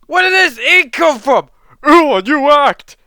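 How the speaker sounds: very high, loud astonished shouts; hammed-up high cries of surprise; shocked spectators. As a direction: exclaim like a stunned crowd. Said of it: "were shocked. They were like".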